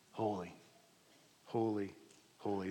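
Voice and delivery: whispered-soft awe; hushed holy. Shown as a demonstration. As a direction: drop to a hushed reverence. Quiet reverent repetition of holy.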